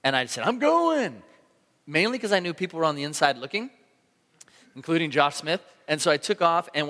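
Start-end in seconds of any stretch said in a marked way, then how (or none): 0.4-1.2 sudden high, loud "I'm going" call; excited shout. Retold or imitated. Pastor retelling his surf takeoff, excited shout. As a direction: shout it like you're committing to the wave.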